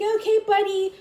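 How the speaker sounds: high, loud exasperated cry; imitating herself at breaking point, high and loud; fed-up outburst. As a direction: snap that you've had enough. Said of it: "she's just like".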